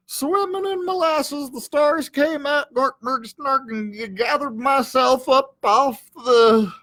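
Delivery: high, slow, dazed rambling; concussed babble. Acted. Stunned dog babbling after the crash, woozy. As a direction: ramble like your head's been rung.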